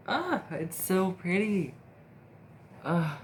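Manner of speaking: slow delighted gush. Real, not acted. Admiring lashes.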